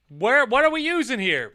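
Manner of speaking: usual speaking voice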